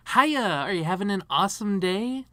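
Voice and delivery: high, loud customer-service chirp; perky counter girl. Retold or imitated. Counter girl greeting a fast-food customer, high and perky. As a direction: greet them high and over-eager. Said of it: "the girl behind the counter said".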